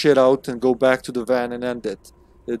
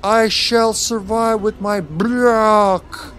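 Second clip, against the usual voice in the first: slow, high, loud mock-heroic declaration; playful, drawn-out war cry; hammed-up battle cry; 9 semitones higher, 5 dB louder, much slower than usual. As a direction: stretch it out like a pretend hero, swelling on the last word.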